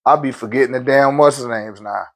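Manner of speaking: usual speaking voice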